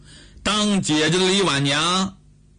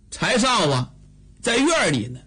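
usual speaking voice

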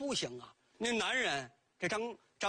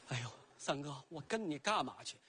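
timid woodcutter; 5 semitones lower, 5 dB quieter than usual. Acted.

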